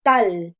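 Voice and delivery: tal said alone, loud and forceful; stressed syllable. Shown as a demonstration. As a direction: put full voice force on tal. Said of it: "Ahí es donde hacemos la mayor fuerza de voz". (tal con la mayor fuerza de voz)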